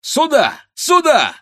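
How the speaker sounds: very high, loud hail; high ringing summons; calling out. A narrator's reading. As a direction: holler to the lads across the street. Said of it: "кричал он".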